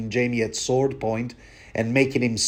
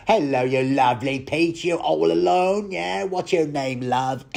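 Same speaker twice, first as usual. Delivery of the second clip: put-on sleazy Cockney villain accent, rising higher on the questions; creepy villain accent; 4 semitones higher than usual. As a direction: lay on the Hollywood villain accent to chat up a girl. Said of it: "villains also have to have this accent".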